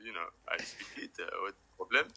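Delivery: a hint of a French speaker, defensive; mildly put-on French accent, quietly bristling; a touch of French accent, annoyed; lightly voiced French accent. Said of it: "he was like".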